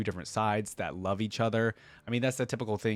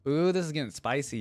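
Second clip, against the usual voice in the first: ordinary delivery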